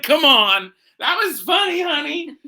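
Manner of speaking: high excited shout. Real, not acted. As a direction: call it out, delighted. Shouting praise, laughing.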